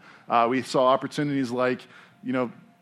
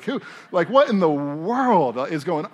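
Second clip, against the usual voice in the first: incredulous; 6 semitones higher, 6 dB louder than usual. Retold or imitated. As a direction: throw up your hands in disbelief. Exasperated retold reaction.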